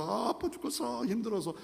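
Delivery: soft, high groaning complaint; faint pained whimper; mock moaning in pain. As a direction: sound weak and suffering. Dying Israelites moaning.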